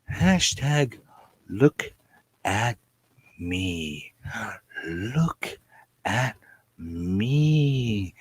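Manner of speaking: word by word, long pauses between; drawn-out slogan. Pretend. Asking viewers to spread the campaign, slowly spaced out. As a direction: stretch out each word, softening on "me".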